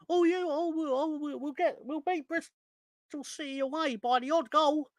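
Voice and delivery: high, silly mocking voice of a deluded fan; mock optimistic fan. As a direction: parody the fans who always believe. Mocking fans' false optimism.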